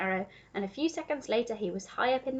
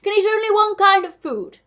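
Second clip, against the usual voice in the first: loud, excited know-it-all duke; crying out; 4 semitones higher, 11 dB louder than usual. A narrator's reading. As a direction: show off knowledge at full voice. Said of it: "cried the Duke".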